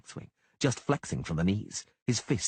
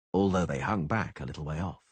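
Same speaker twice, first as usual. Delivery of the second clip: ordinary delivery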